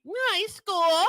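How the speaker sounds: high, put-on mimicking voice; mock someone's way of talking up high; high-pitched teasing impression; imitating Brent. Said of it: "you have Brent that's like".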